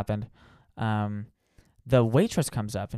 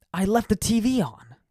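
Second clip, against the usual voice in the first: higher, louder, horrified; panicked recollection, pitched up; dismayed realization; 9 semitones higher, 3 dB louder than usual. Retold or imitated. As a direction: realize the mistake out loud. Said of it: "I'm like".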